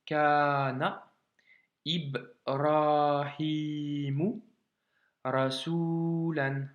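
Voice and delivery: Kana, Ibrahimu, Rasulan slowly with long pauses; sentence broken up. Shown as a demonstration. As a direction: detail each word for the learner. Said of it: "si on détaille". (phrase détaillée mot par mot, lentement)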